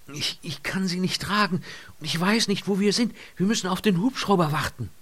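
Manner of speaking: high-pitched, agitated pleading; urgent explaining. A narrator's reading. Lynott explains she cannot carry him, agitated. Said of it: "sie fuhr fort zu erklären".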